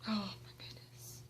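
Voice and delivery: breathless hushed whisper; real starstruck whisper; awed whisper. Real, not acted. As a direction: whisper it, overwhelmed. Overwhelmed by meeting the band.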